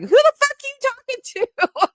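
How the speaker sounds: high mock bewilderment; mock confused self. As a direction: play your own baffled self. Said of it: "and I'm like".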